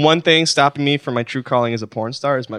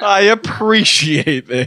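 Danny impression; 6 semitones higher, much slower than usual. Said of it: "That was closer".

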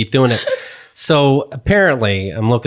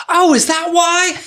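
high squeal of mock discovery; mom's amazed reply; 4 dB louder than usual. Mom learns from daughter about hair art, amazed high voice. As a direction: imitate Mom, very high-pitched.

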